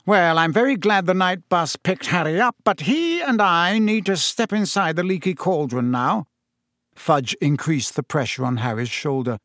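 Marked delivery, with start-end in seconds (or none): 0.0-6.3 1 brisk Minister. A narrator's reading. Fudge takes charge of Harry.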